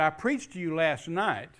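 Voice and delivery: re-living the sermon at full force; retold preaching. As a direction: preach it to the crowd.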